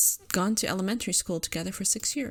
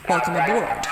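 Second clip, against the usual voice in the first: loud, cold speaker announcement; cold robotic voice; 6 dB louder than usual. Acted. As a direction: announce it coldly over a speaker. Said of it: "a cold, airy voice filled the room".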